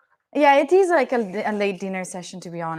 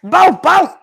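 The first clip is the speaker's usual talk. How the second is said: loud, higher bow bow bark; German dog bark; 8 semitones higher, 14 dB louder than usual. Pretend. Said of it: "the German will be".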